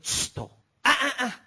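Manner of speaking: high, loud shushing and tutting; mock scolding hush. Pastor silencing a woman crying in prayer, scolding hush. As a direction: cut someone off with a sharp shush.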